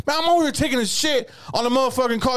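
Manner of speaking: high, mock-angry complaint; retold irritation. Man complaining to his caller, irritated. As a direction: imitate him griping into the phone. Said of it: "He starts getting mad at me".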